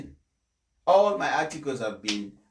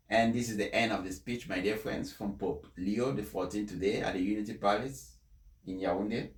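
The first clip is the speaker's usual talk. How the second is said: ordinary talk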